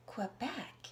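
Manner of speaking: ordinary delivery, nothing marked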